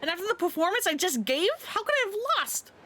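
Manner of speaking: can't believe she lost, pitch climbing; shocked disbelief. Acted. Rival shocked at losing.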